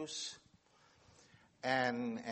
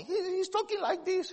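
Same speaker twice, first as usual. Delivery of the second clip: squeaky falsetto, much higher; friend's funny voice; 4 dB louder than usual. Shown as a demonstration. Demonstrating his friend's funny high voice for the audience. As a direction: show off the tall friend's odd high voice. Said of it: "his voice is quite funny".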